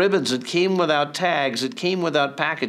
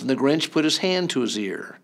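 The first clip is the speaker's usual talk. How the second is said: ordinary delivery, nothing marked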